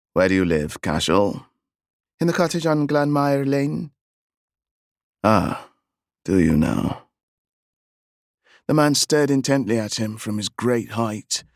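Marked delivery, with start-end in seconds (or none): 0.1-7.1 a lightly voiced English gentleman; clipped English tones on the stranger; English accent. A narrator's reading. English horseman talks to the boy in a lowered English accent. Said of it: "His accent was strange".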